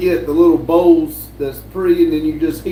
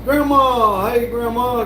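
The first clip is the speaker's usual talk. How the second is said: excited greeting; 7 semitones higher, slower than usual. Real, not acted. Greeting grandma arriving in chat.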